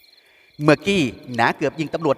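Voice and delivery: ordinary delivery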